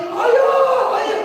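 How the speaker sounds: put-on high, loud child's cry for dad; child screaming. Son cries out for his father from class, high loud wail. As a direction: wail for ayah like a small crying child.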